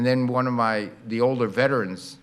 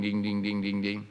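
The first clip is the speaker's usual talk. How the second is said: lower, soft dings as the train passes; receding bell dings; 3 semitones lower, 5 dB quieter than usual. Shown as a demonstration. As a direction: let the bell drop in pitch as it slides past. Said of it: "and as it goes past the crossing".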